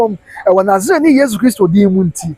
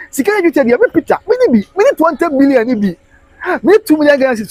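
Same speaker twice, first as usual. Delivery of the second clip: higher, mimicking a greedy beggar; mock beggar; 8 semitones higher than usual. Preacher mimicking the beggar demanding cedis.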